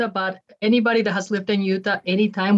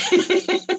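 laughing; 12 semitones higher than usual. Real, not acted. Host teases her about tacos.

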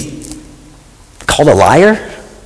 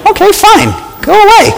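much higher, breezy brush-off; dismissive retort; 12 semitones higher than usual. Pretend. Preacher answering someone who dislikes him, breezy and dismissive. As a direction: wave them away, unbothered.

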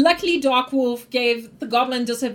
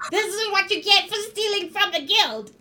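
goblin threat; 8 semitones higher than usual. Acted. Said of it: "and say".

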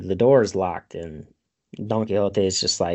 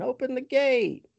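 high-pitched mock cry; half-hearted herald's call, pitched high; imitated calling out; 14 semitones higher than usual. Retelling the repeated gate cry, mock calling. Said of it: "you hear".

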